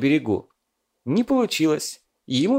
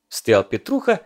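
ordinary delivery

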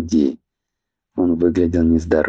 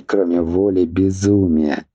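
ordinary delivery

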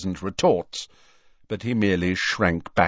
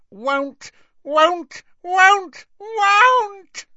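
screaming house elf; 5 dB louder, much slower than usual. A narrator's reading. House elf refusing his new master, rising scream. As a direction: croak risen to a very high scream. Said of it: "Creature's voice had risen to a scream".

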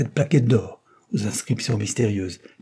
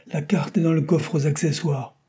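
called out higher from behind the plane; calling out; 6 semitones higher than usual. A narrator's reading. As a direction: call across the hangar, pitched up. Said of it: "Mécano cria".

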